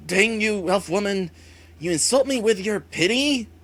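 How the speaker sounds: a wounded villainess spitting fury; angry villain shout. Acted. Villain Wraith rages at elf woman.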